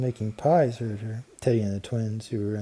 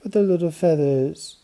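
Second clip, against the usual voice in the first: put-on higher, louder child voice; little girl; 6 semitones higher, 6 dB louder than usual. A narrator's reading. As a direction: protest like an upset little girl. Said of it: "said Babs".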